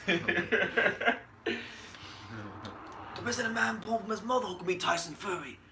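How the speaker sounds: lightly voiced boxer's boast; a hint of a boasting fighter; Tyson Fury. Host retries the requested boxer impression, lightly put-on boast. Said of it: "they want a Tyson Fury impression".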